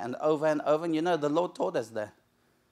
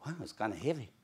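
strained mutter; 5 semitones lower, 9 dB quieter, faster than usual. Retold or imitated. David straining under armor.

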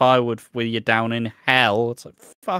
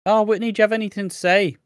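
high, fast sing-song prompt; mock the sugary question; mock teacher prompt; 10 semitones higher, 5 dB louder, faster than usual. Host mocks the group asking Whitney, high and fast. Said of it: "It's like".

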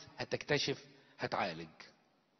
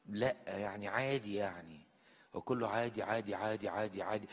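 shrugging indifference; 4 semitones lower, 4 dB quieter than usual. Retold or imitated. Voicing indifferent believer.

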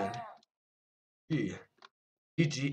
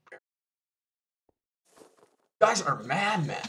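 usual speaking voice

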